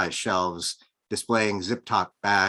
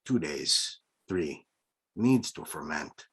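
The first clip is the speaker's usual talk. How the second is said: put-on unhurried Mexican accent, a touch lower and softer; old Mexican shopkeeper, in a Mexican accent; lightly voiced Mexican priest; rich deep Mexican accent; 4 semitones lower, 4 dB quieter, slower than usual. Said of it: "announced in a rich, deep Mexican accent".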